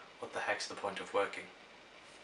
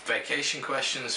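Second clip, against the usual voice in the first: normal talking voice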